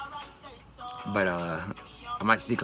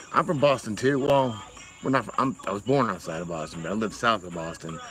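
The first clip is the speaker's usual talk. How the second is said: ordinary talk, nothing marked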